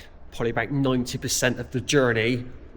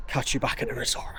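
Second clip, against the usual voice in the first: breathy whisper to camera; whispered aside; 6 dB quieter than usual. Real, not acted. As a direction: murmur it under the breath. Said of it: "he says".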